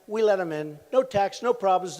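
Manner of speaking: normal talking voice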